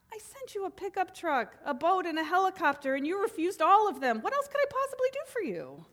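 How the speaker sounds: God's exasperated reply. Acted. God answering the drowned man, lightly voiced and exasperated. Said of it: "God replied".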